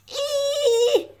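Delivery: very high chick squeal; play squeal. Acted.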